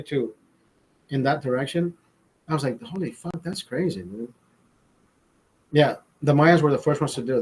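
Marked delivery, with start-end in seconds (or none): 2.8-4.3 softer, hushed awe; quietly blown away by the echo; awed amazement. Retold or imitated. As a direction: breathe it out in quiet wonder. Awed by the arena acoustics.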